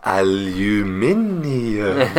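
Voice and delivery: slow, drawn-out "Aluminium", every syllable; mock posh lecture on "Aluminium". Shown as a demonstration. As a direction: roll out "Aluminium" in full British style. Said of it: "Listen to me".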